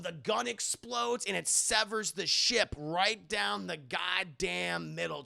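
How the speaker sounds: ordinary talk, nothing marked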